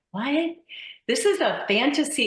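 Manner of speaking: baffled reaction. Retold or imitated. Retells puzzlement at audition copy.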